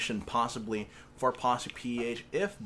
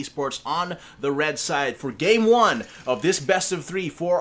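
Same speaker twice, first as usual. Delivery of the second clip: higher, louder esports caster; hype caster intro; 6 semitones higher, 8 dB louder than usual. Acted. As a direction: announce it like a big final. Hyped shoutcast game intro.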